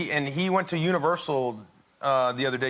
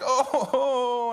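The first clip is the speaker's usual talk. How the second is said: higher-pitched mock wail; imitated crying; 9 semitones higher than usual. Dad retelling his son hurt by a fish, mock crying. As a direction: wail "oh" like a hurt child, hammed-up. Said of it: "He starts to cry".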